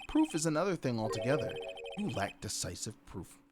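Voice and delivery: normal talking voice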